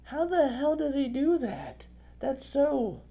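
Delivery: high-pitched, drawn-out disbelief; astonished. Retold or imitated. Quotes own astonished reaction.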